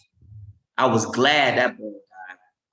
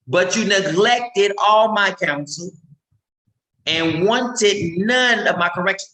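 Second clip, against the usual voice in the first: scripture reading; 7 semitones higher, slower than usual. Acted.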